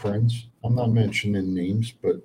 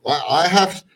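louder, higher fired-up host; rant show host; 4 semitones higher, 5 dB louder than usual. Acted. Hosts his rant segment.

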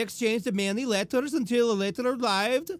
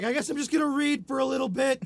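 lightly acted, higher and quicker; a hint of put-on resignation, raised pitch; mock resigned sleeper; 5 semitones higher, faster than usual. Man sitting up in bed, mock resigned.

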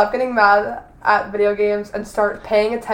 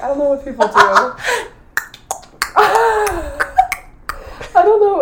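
high, loud laughing admission; 10 semitones higher, 5 dB louder, faster than usual. Real, not acted. After a failed sound imitation, laughing loudly. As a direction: laugh through it.

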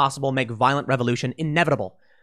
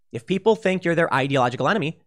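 usual speaking voice

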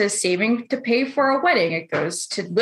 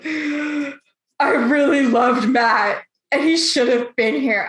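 mock tearful wail; 3 semitones higher, much slower than usual. Crying girl at wedding.

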